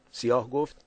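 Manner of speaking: plain reading voice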